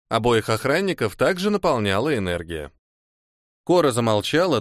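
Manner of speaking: usual speaking voice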